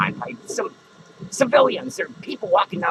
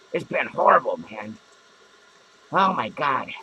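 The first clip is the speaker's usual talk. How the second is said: ordinary talk, nothing marked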